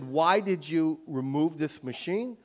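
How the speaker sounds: ordinary delivery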